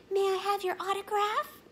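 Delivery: put-on girl asking the swan for an autograph, high and eager; young fan girl. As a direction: ask sweetly and eagerly.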